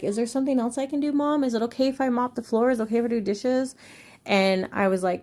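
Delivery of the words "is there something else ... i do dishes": put-on high, fast, eager little girl asking; eager child voice. Daughter asking mom for more chores, high and eager. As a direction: imitate an eager helpful daughter. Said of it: "be like".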